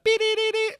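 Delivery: very high squealing notes; harmonic imitation. Imitating the guitar squeal, very high. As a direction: sound like a pinch harmonic. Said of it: "hits that".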